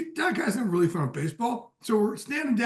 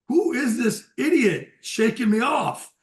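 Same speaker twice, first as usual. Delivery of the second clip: imitating Piazza; 5 semitones higher, 6 dB louder, slower than usual. Piazza's reaction voiced in story.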